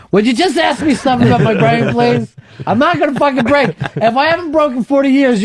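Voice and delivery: high, exasperated pleading; exasperated demand. Acted. Host mock-pleading to be asked about his brain, exasperated.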